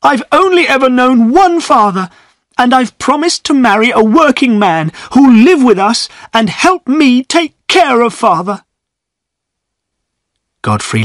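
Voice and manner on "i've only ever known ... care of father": much higher, pleading through tears; tearful, begging to stay with father; crying out, near sobbing. A narrator's reading. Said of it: "cried Eppie, tears running down her face".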